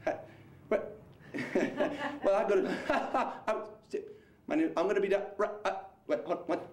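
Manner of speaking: words chopped by dropouts; cell phone breaking up. Pretend. Prankster talking to a stranger like a bad cell connection, cutting out. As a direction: stutter out broken scraps with sudden gaps. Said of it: "as if it's a cell phone breaking up".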